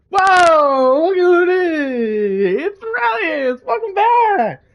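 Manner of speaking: excited greeting. Acted. Derek welcoming Relius back, loud and high. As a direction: greet an old friend with loud delight. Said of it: "he's like".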